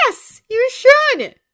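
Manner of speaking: high, loud, slow scold; scolding shout. A narrator's reading. Glinda scolding Elphaba, loud and slow. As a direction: drag it out reproachfully.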